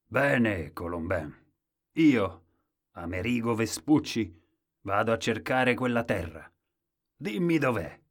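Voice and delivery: loud, emphatic seafarer; bold, loud explorer's declaration; Amerigo Vespucci. A narrator's reading. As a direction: announce it grandly, in separate phrases. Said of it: "il navigatore barbuto si alzò e disse".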